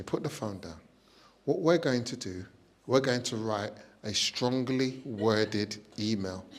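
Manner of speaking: calm condescending posh friend; posh friend Lily calmly instructing, softly posh. Retold or imitated. Lily's posh voice.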